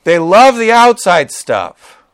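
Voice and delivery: ordinary talk, nothing marked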